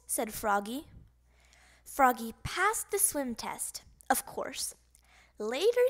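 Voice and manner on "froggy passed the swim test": plain reading voice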